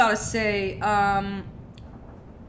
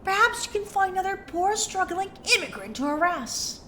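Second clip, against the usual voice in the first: snooty, higher socialite sneer; Jennifer Coolidge voice; 4 semitones higher than usual. Acted. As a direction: look down your nose at him. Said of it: "[name] sound like Jennifer Coolidge".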